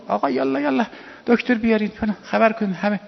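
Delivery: frantic household crying out for help; retold panic. Panic as the imam lay dying. (صدای بلند و هراسان اطرافیان)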